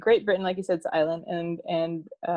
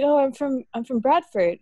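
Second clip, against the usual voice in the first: clearer retelling, a bit louder; spoken up; 6 semitones higher, 4 dB louder than usual. Retold or imitated. Asked to speak up, she repeats the coworker's line louder. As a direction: repeat "I'm from Bradford" clearly for the listener. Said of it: "can you speak up".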